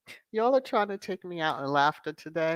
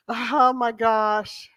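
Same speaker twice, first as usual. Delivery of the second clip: ordinary talk, nothing marked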